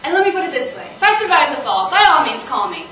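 loud, emphatic sarcasm; scathing send-off. Acted. Woman rejecting her date's request to call again, scathing. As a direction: punch out each phrase loudly with contempt. Scornful answer to calling again.

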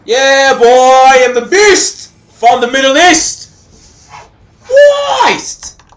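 high, very loud victory cry; triumphant shouting. Real, not acted. Gamer celebrates his win, triumphant shouting. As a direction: holler it like a champion boasting.

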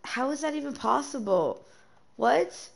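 real astonishment; stunned disbelief. Real, not acted. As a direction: voice jumps up in shock. Bike deleted by mistake.

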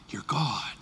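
awed unvoiced whisper. Real, not acted. Pastor whispering in awe before God. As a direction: hush it in awe.

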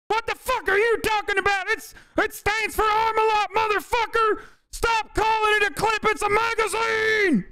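hammed-up outraged pedant, correcting everyone; mock gun nerd rant. Mocking a pedantic gun enthusiast.

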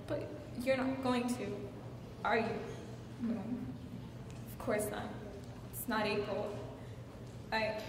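soft, halting admission with long pauses; subdued confession. Acted. Wife admitting the truth to Charles, subdued. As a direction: let the bravado drop and confess quietly. Wife's deflated confession to husband.

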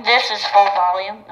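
loud through the megaphone at full blast; megaphone at full volume. Shown as a demonstration. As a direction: crank it up and speak into the megaphone. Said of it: "That's not full volume".

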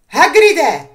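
calling out loud and high; shout. A narrator's reading. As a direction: shout his name through the door. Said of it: "křikla Hermiona". (hlasitě zavolat)

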